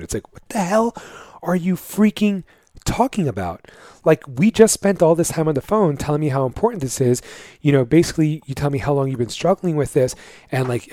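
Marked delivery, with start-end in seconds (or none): none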